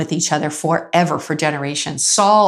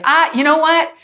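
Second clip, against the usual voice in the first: higher, airy imitation of the guard backing down; guard caving in, voiced higher and breezy; mock sheepish guard; 8 semitones higher than usual. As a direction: play the guard suddenly sorting it out. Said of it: "Russian guard guy was like".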